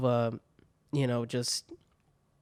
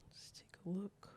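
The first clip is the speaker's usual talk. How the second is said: near-voiceless whisper, much softer; whispering to himself; 5 semitones higher, 18 dB quieter than usual. Real, not acted. Streamer to himself while looking through Reddit, whispering. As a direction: whisper it absently under your breath.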